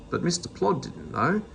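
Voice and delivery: usual speaking voice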